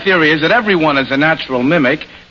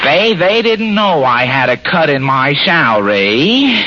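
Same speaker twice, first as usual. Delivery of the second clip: nasal drone with a little more feeling; Fred Allen; 3 semitones lower than usual. Shown as a demonstration. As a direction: play a dry radio comedian working the crowd. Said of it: "to have an artist impersonate me".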